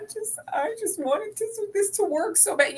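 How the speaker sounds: high, soft, put-on tearful whine; mock crying. Co-host imitating the crying wife, mock tearful. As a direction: imitate someone pleading through tears. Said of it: "And then she keeps crying".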